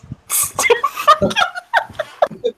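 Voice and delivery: cracking up laughing; loud laughter. Real, not acted. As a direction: burst of helpless laughter. Breaks up laughing.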